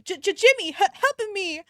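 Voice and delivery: high, loud stammered plea; whimpering plea. Acted. Dying girl begging Jimmy for help. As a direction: stutter out a desperate cry for help. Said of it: "she whimpered".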